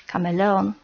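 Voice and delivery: ordinary delivery, nothing marked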